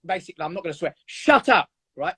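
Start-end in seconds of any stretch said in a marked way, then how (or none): 1.1-1.7 higher, firm shut up at fear. Retold or imitated. Speaker telling his fear voice to shut up, firm and raised. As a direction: snap it shut at the voice. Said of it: "what I say is".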